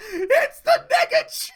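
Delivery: high, loud squeal through laughter; helpless shrieking laughter on the words; real laughing. Real, not acted. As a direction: crack up while saying it. Cracks up at own coined name.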